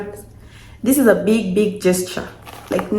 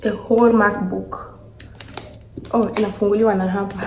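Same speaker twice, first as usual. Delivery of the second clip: ordinary talk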